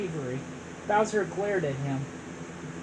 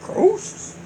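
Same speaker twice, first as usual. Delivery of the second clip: high, breathless puzzled question; 12 semitones higher, 5 dB louder than usual. Acted. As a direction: ask it confused.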